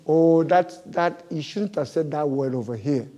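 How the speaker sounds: ordinary talk, nothing marked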